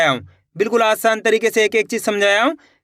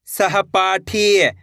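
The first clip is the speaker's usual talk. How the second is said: slowly joined syllables; slow blend; much slower than usual. Shown as a demonstration. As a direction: draw the word out syllable by syllable. (धीरे धीरे जोड़कर)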